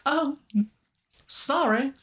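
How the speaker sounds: high, startled apology; caught kid. Retold or imitated. Kid caught stealing candy apologizes in a high startled voice.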